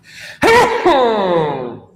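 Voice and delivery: loud real sneeze; a sudden sneeze. Real, not acted. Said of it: "axsıracağım".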